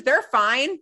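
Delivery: normal talking voice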